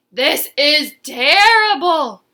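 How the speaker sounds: loud, slow, despairing wail; crying out. Acted. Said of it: "cried Verdi".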